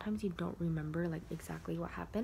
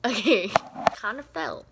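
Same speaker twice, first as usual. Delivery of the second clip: louder, higher laugh at a slip; 4 semitones higher, 10 dB louder than usual. Real, not acted. To viewers after something falls, laughing. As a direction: laugh off the mishap.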